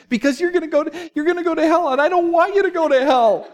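put-on very high, pleading child's voice through tears; crying little girl. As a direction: imitate a small girl begging through tears. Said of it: "starts crying on my doorstep".

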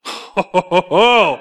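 big drawn-out "oh-ho-ho" laugh; incredulous stagey chuckle; mock laugh. Reacts to mom's blunt advice.

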